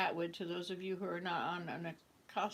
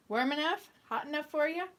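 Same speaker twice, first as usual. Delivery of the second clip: louder Canadian hoser voice with a rising question; 7 semitones higher, 5 dB louder than usual. Retold or imitated. [name] mimics a hoser asking about the weather, loud hoser voice. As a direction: ham up a cheery Canadian hoser. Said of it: "perfect imitation of a Canadian hoser".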